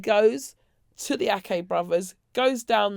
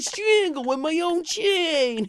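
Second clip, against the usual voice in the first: high, drawn-out show-off voice; mock showman; 5 semitones higher, 3 dB louder than usual. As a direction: stretch it out as a hammed-up boast. Acting out the supposed staged show.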